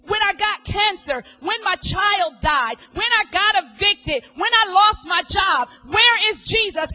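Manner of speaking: loud, pleading questions building; a grieving member shouting at the preacher; desperate questioner. Retold or imitated. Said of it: "And you say".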